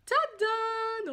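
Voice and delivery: high, loud drawn-out reveal; big excited ta-da; triumphant tada. Real, not acted. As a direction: sing it out as a fanfare. Reveals the palettes.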